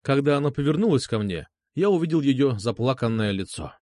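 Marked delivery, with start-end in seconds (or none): none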